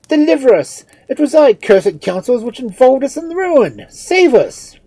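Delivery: high, very loud shouting; desperate priest demanding rescue; frantic accusation. A narrator's reading. Priest seizes and accuses Cheops.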